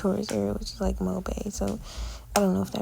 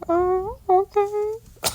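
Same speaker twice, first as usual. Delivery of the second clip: high, louder squeaky oh okay; awkward surprise; 11 semitones higher, 5 dB louder, much slower than usual. Retold or imitated. Her reply to the creepy man, a high startled oh okay. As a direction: go way up, caught off guard.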